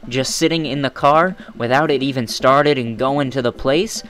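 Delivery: ranting. Real, not acted. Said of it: "Enough of my rant".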